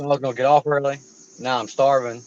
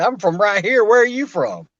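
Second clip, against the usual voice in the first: high, loud comeback; imitating an insistent comeback; mock retort; 8 semitones higher, 5 dB louder than usual. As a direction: fire the question straight back, high and loud. Acted retort to questioner.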